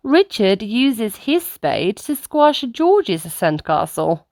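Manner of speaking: ordinary delivery, nothing marked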